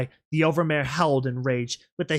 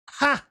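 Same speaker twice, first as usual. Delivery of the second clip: scornful laugh; 12 semitones higher, 3 dB louder than usual. Acted.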